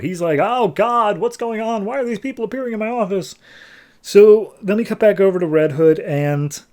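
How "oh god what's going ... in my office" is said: high, fast panicked babbling; freaking out. Retold or imitated. Therapist panicking as villains appear in his office, high and frantic. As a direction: panic as strangers pop into your office. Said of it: "freaking out".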